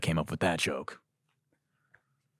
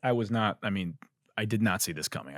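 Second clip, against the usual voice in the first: usual speaking voice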